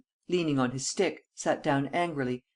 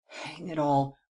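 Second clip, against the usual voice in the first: restrained exasperation; slower than usual. A narrator's reading. Premier balking at demand for an arrest, restrained exasperation. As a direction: grumble it, holding back.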